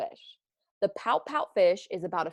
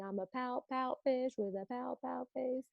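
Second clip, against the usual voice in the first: much softer, higher mopey chant; a hushed sulky fish; pout fish refrain; 5 semitones higher, 10 dB quieter than usual. Acted. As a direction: murmur the fish's glum line. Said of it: "he says the same thing".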